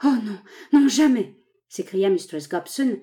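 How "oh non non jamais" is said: louder, higher cry of refusal; frightened cry of refusal to her lover; terrified cry. A narrator's reading. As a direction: cry out in terror. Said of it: "avec un accent d'indicible terreur".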